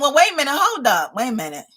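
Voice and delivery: ordinary talk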